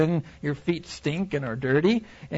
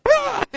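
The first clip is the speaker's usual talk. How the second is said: high startled yelp; 5 dB louder than usual. Pretend. Preacher acting out his own panic, startled yelp. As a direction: jump back with a shriek. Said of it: "I'd be like".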